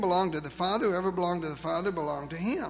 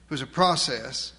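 ordinary talk, nothing marked

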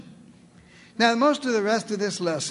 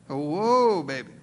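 mock alarm; 4 semitones lower than usual. Mocking a presumptuous prayer.

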